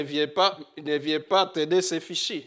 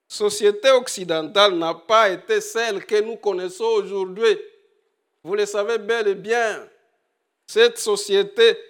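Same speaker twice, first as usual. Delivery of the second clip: full-force preaching; 5 semitones higher, 6 dB louder than usual. Acted. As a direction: hammer the warning home to the congregation. (prédication ardente, plus forte et plus aiguë)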